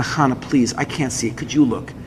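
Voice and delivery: low, hushed, weary plea; quiet pleading. Retold or imitated. Exhausted husband asking his wife to search, quietly pleading. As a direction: murmur a worn-out request for help.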